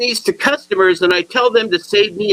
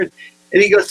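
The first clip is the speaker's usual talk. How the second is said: ordinary talk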